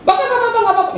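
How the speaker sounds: squeaky, eager reassurance from the host; coaxing host saying it's no trouble, high and eager; high insistent host. Retold or imitated.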